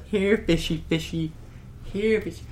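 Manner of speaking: put-on high childish coaxing, cracking into a laugh; little kid voice. Kid in the video calling fish, mock childish coaxing. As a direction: coax the fish like a small kid. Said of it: "he goes".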